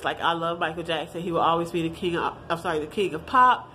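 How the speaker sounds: ordinary delivery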